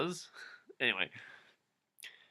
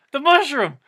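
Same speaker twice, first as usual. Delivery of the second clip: mock excitement; 12 semitones higher, 8 dB louder than usual.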